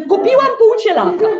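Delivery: wyżej i głośniej, z dumą; ogłoś zakup jak dumna matka; matka się chwali. Retold or imitated. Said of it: "moja matka". (matka chwali się córce zakupem, wyżej i głośno)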